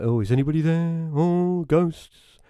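high, drawn-out spooky wail, taking the mick; mocking ghost-calling. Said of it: "he's just having a laugh".

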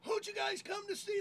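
very high emcee call; hype call. Retold or imitated. Opener asking crowd who they came to see, high hype call.

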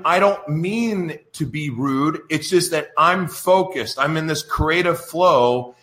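usual speaking voice

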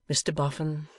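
usual speaking voice

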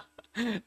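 sudden high laugh; high burst of laughter. Real, not acted. Fan picturing another Panenka, bursting into laughter. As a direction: laugh out at the absurd thought.

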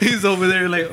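talking through a laugh; words bubbling with real laughter. Real, not acted. Laughing while picturing the attendant. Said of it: "That's an accent".

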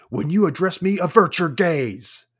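loud, high, imperious order; pompous, outraged command at full voice; commanding villain. Acted. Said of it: "And he says".

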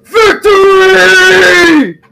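very long, high, loud scream; screamed victory cry. Acted. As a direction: scream it in triumph, held long. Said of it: "he will scream".

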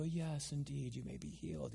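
very soft, saintly mock-holy voice; hushed haloed-holy-man parody; pious, nice Jesus. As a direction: grant it gently, with exaggerated piety. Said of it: "all very nice and pious and stuff".

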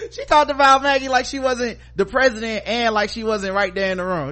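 ordinary talk, nothing marked

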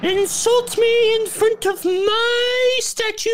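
angry goddess. Retold or imitated. Retelling the film, narrator voices the goddess cursing the queen. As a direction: shriek the curse like an offended deity. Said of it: "she's like".

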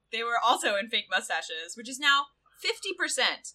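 ordinary talk

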